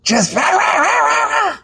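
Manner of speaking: high, barking rah rah; a playful mock bark; mock yapping. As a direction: imitate a tiny dog yapping. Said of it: "yappy puppy".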